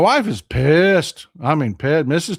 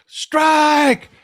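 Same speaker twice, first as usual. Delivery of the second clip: umpire call; 9 semitones higher than usual. Retold or imitated. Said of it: "and the umpire went".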